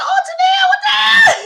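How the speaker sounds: very high, loud laughing cry; shocked laughing shout. Real, not acted. Speaker reacting to Tadell's jab, shrieking. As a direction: shriek it in delight.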